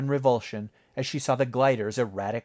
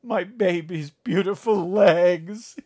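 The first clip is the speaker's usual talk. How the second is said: higher, louder, slow wailing lament; crying father; 8 semitones higher, 7 dB louder, slower than usual. Acted. Father over Natalie's broken leg, crying. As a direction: weep over the legs. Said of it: "he cried".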